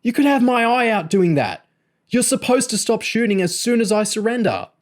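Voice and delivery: very high-pitched indignant yell; howled protest. A narrator's reading. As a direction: wail an outraged complaint. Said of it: "James howled".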